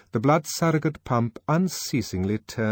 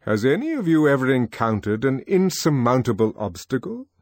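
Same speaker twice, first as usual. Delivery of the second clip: stately controller; 5 semitones higher, 5 dB louder than usual. Acted. Mond questioning the boys.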